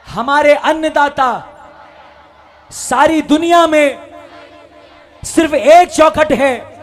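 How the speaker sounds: rising to a slow shout; building call. Real, not acted. Rousing the crowd, building up.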